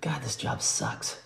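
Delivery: soft, slow grumble under the breath; muttered aside. Retold or imitated. New hire complaining to himself, soft slow mutter. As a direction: mutter it so nobody hears.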